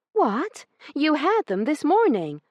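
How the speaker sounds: high, loud, shocked lady's cry; shocked outrage. Acted. Io hearing the batch is sold, shocked and high. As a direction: gasp it out in disbelief.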